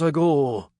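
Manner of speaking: put-on a pompous French speaker, heavy French accent. A narrator's reading. Frenchman addressing a conference hall, heavy French accent. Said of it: "In his French accent".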